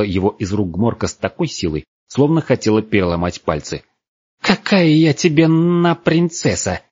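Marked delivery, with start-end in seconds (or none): 4.4-6.8 high, drawn-out outrage; indignant outburst. A narrator's reading. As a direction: snap back in a high, offended voice. Furious girl rejects being called princess.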